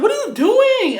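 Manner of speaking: high-pitched retold challenge; indignant disbelief. Friend confronting boyfriend over the kick, high indignant disbelief. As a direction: confront him, voice leaping up.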